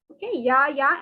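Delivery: ordinary delivery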